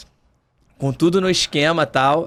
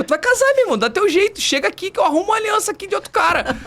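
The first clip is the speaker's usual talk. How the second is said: exasperated groom; 13 semitones higher, faster than usual. Retold or imitated. Groom yelling at bride.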